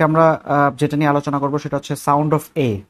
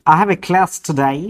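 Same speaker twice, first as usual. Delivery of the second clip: slow American English, class with a flat a; American reading; American accent; 3 semitones higher, slower than usual. Shown as a demonstration. Teacher says it the American way.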